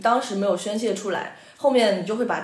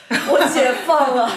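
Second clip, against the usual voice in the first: excited relief; 5 semitones higher, 7 dB louder, slower than usual. Retold or imitated. Recalling feeling freed in argument.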